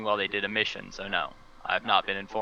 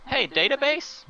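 much higher, bright call to the database; 7 semitones higher than usual. Acted. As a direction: hail the computer over comms. Calling database over comms.

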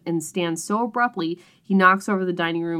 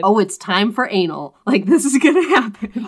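loud, rising excited anticipation; mock excitement; 2 semitones higher, 8 dB louder than usual. Reader telling her co-host what she expected, excited. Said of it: "Oh, you were excited".

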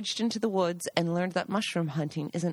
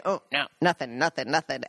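evasive denial; 6 semitones lower than usual. Retold or imitated. Hunters deny finding anything.

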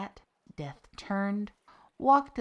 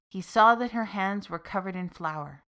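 plain reading voice

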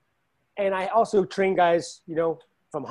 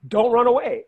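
loud, raised-pitch command; exclaimed order, higher and louder; emphatic mock advice; 6 semitones higher, 6 dB louder than usual. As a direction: call it out bluntly as others would. Acts out people's blunt advice.

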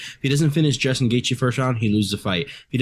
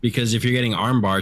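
usual speaking voice

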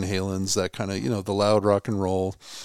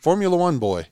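mock-scoffing retort, pitched up; retold scoff; 7 semitones higher than usual. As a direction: imitate a dismissive Scot, voice lifted. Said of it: "he scoffs and he goes".

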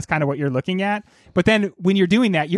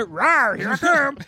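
goofy monster growl, then higher; shark voice; 7 semitones higher, slower than usual. Pretend. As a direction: play a silly hungry shark. Playing the shark.

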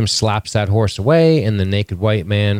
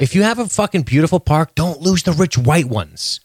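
rising higher to a near yell; exasperated rant; 8 semitones higher than usual. Real, not acted. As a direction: build the rant to a peak.

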